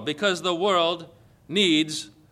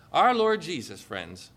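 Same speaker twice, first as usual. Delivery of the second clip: usual speaking voice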